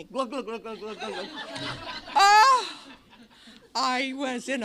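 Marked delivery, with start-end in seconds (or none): 0.0-1.3 mock gulping noises through a laugh; playful glug glug sound effect. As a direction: imitate chugging champagne, giggling. Imitating drinking from bottle.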